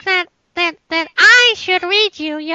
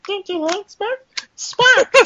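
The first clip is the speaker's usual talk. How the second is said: soft put-on character voice; G2 Spark voice; 3 dB quieter than usual. Guest teases host as Spark, soft character voice. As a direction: plead softly as the character. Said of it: "that G two Spark voice".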